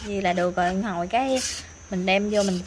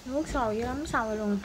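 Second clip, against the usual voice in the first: normal talking voice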